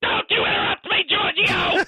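sudden high, hammed-up angry yell; indignant outburst. Draft analyst scolding host for interrupting, indignant. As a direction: explode at being cut off.

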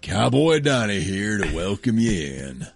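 lightly voiced Texas cowboy host; a hint of a cowboy drawl. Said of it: "what kind of cowboy voice you wanna use".

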